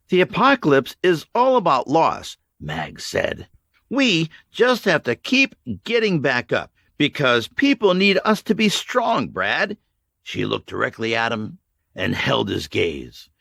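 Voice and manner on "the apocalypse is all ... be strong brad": higher, firm encouragement; Mags, resolute. A narrator's reading. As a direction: steel the boy to keep going. Mags bracing Brad.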